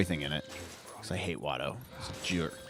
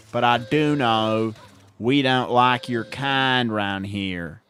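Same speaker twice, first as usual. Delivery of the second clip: slow, loud Southern drawl; hammed-up Tatooine local in a Southern drawl; country accent; 3 semitones higher, 4 dB louder, slower than usual. Playing a suspicious Tatooine local, hammed-up country accent. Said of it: "has a country accent".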